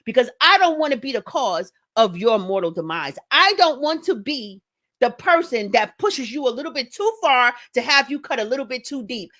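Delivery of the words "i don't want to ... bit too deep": ordinary delivery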